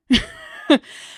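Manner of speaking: breathy nervous laugh. Real, not acted. Recalling hitting herself, a real nervous laugh. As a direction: laugh off an uncomfortable memory. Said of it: "pardon my nervous laughter".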